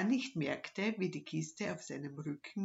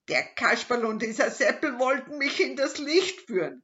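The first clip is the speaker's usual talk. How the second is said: loud, higher angry growl; 7 semitones higher, 9 dB louder than usual. Acted. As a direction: snarl it out loud and high. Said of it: "knurrte er".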